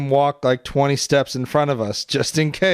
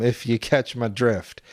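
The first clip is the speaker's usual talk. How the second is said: ordinary talk, nothing marked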